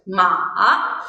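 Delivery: low dipping "ma"; "ma" dips deep and rises at the end; third tone. Shown as a demonstration. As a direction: take "ma" down, then bring it up. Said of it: "and then I go up".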